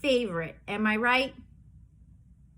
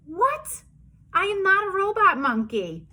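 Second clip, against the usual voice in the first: much higher, indignant denial; shocked; 6 semitones higher than usual. Acted. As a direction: gasp then deny it. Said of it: "making me say these crazy things".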